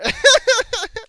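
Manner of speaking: sudden high squeal of laughter; high burst of laughter. Real, not acted. Joking to viewers about plot armor, high squeal of laughter. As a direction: let a shrill laugh burst out.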